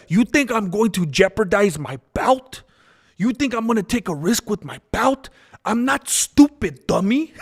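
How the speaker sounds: mocking Adesanya. Streamer mimics Adesanya's press conference taunt, high and childish. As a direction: sneer it like a smug champ. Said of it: "But he's like".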